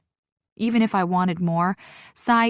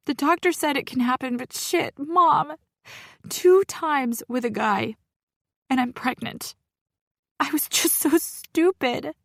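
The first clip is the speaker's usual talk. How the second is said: sobbing; 7 semitones higher than usual. Acted. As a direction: sob it out, voice cracking high. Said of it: "as I sob into the phone".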